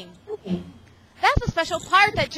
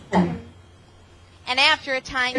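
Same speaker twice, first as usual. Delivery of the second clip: plain reading voice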